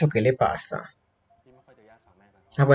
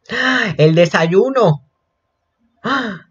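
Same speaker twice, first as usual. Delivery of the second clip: ordinary talk, nothing marked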